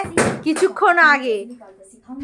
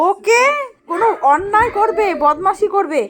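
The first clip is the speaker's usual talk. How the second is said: ordinary delivery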